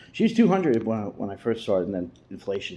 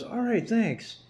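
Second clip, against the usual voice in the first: much higher mocking voice of the uncle; slower than usual. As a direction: ape the thieving uncle, high and snide. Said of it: "he goes".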